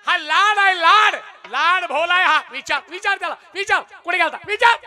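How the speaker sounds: a hammed-up angry husband yelling; angry father. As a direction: imitate a father railing at his wife.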